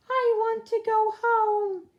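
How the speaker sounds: very high, loud wailing plea; homesick little rabbit. A narrator's reading. Smallest rabbit begging the group, a very high loud wail. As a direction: cry out for home like a tiny exhausted bunny. Pipkin pleads, voiced high.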